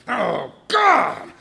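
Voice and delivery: loud, high, startled cry; startled exclamation. Retold or imitated. Driver startled by a honking car, a loud high cry. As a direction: jump at the honk.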